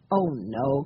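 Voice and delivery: usual speaking voice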